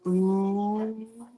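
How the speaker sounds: ooh drawn out softly as a lone vowel; teaching the vowel ooh; sounding out ooh. Shown as a demonstration. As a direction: model the ooh sound for a child. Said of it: "Now you're gonna say".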